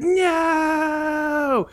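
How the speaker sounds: high hammed-up "No!"; mock movie scream. Mocking the driver's drawn-out scream with a high hammed-up "No!". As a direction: wail like a cheesy B-movie victim. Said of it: "He's like".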